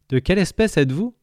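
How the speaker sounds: a touch of character voice. Acted. Rosemary voice.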